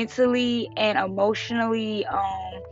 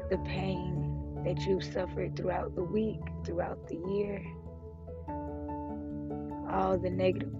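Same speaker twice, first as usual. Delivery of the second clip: calm breathing guide, fading softer; guided meditation; 6 dB quieter than usual. Acted. Leads a breathing check-in.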